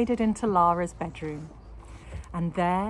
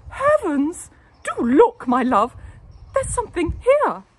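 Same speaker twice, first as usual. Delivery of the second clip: very high, loud exclamation; shocked wife; 11 semitones higher, 12 dB louder than usual. A narrator's reading. Wife finding something in the teapot, high and loud. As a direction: cry out in shock. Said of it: "she nearly fainted".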